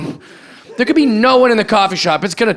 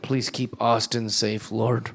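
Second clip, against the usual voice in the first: quiet mock prayer; 8 semitones lower, 12 dB quieter than usual. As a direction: pray softly like it's bedtime. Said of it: "I'm always like".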